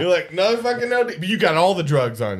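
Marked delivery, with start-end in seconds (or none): 0.3-1.2 higher, hammed-up jaded complaint; exasperated mock groan. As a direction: overplay the fed-up medic. Mock bored first responder again.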